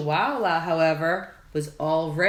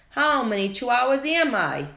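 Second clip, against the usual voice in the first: wondering aloud; 6 semitones higher, 4 dB louder than usual. A narrator's reading. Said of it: "he wondered out loud".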